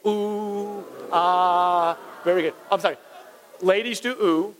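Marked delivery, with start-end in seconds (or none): 0.1-0.9 long, level crowd-style "ooh"; sustained cue of wonder; drawn-out ooh. Shown as a demonstration. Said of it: "Everybody go". 1.1-2.0 long, level crowd-style "aah"; sustained cue of wonder; drawn-out ah. Shown as a demonstration. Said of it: "Everybody go".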